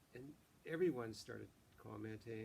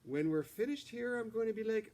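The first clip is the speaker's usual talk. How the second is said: much higher and louder, bubbly; eager practice voice; 10 semitones higher, 11 dB louder than usual. Acted. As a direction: rehearse it with big energy. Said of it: "that was practice".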